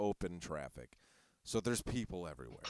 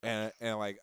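usual speaking voice

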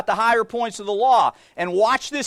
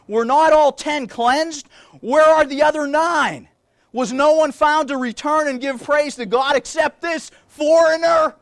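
preacher voicing Jesus' reproach; forceful preaching; 4 semitones higher, 4 dB louder than usual. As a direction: challenge the crowd with it. Preacher reads Jesus' question.

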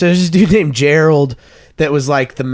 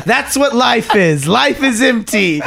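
much higher, slow, hammed-up solemn verdict; mock solemn preacher; 8 semitones higher, slower than usual. Camp leader preaching to the teams, slow mock-solemn. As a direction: deliver it like a sermon's grand lesson. [name]'s sermon punchline.

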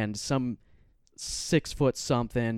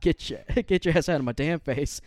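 laughing through the words; cracking up mid-insult; 5 semitones higher, 3 dB louder than usual. Retold or imitated. Laughing while retelling.

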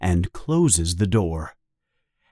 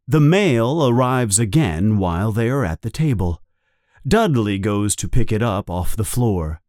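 usual speaking voice

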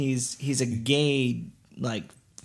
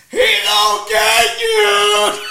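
drawn-out high shout; hammed-up heckler's yell; very loud; 7 dB louder, much slower than usual. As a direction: bellow it slowly across a theater. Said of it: "So loud and".

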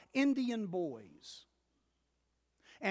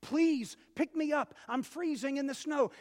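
higher, louder, urgent begging; the snake begging louder and higher; desperate snake; 7 semitones higher, 5 dB louder than usual. Acted. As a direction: cry out to be saved. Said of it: "the snake says".

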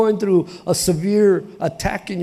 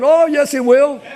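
higher and louder, "will" punched; emphatic preacher rebuttal; emphatic reply; 8 semitones higher, 4 dB louder than usual. Real, not acted. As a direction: insist it with fire. Preacher answering the doubt.

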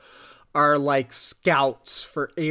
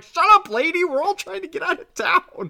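rude panicked yell; 12 semitones higher than usual. Retold or imitated. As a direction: bark it at her like a fed-up local. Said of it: "they're like".

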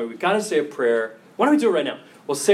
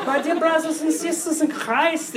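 much higher, laughing pope impression; high papal voice; 10 semitones higher than usual. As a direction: mimic the pope's high sing-song greeting. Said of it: "Y'all have really high voices".

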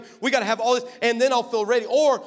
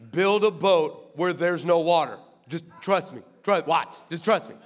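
low, hushed coaxing voice; low and reassuring, as if letting someone in on a plan; coaxing God; 6 semitones lower than usual. Acted. God coaxing Noah, acted.

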